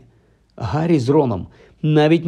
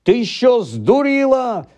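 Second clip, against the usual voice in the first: high, drawn-out incredulous yelp; exasperated screech; 10 semitones higher, slower than usual. A narrator's reading. Ron to Hermione as she forgets magic, screeching. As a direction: screech in disbelief at her silliness. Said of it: "заверещав Рон".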